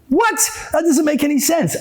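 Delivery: high-pitched mock incredulity; baffled disbelief. Listener hears of killing over beliefs, incredulous. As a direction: burst out in acted bafflement.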